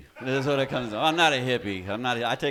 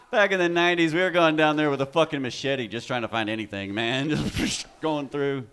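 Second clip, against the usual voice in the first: ordinary talk, nothing marked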